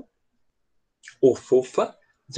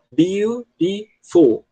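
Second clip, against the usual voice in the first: slow, higher, syllable by syllable: beau-ti-ful; counting syllables; 5 semitones higher, much slower than usual. Shown as a demonstration. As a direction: spell out "Beautiful" beat by beat, slowly and higher. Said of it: "se você contar as sílabas".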